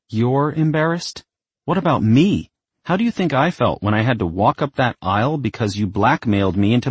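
building from hurt to a shout; rising to a yell. Acted. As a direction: climb toward a yell. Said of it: "As Isabel's voice rose to a yell".

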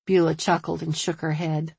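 plain reading voice

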